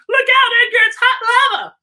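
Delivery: high, loud mock alarm; playful warning. Owner warning dog Edgar about hot lava, mock alarm. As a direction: yell it like a kid at play.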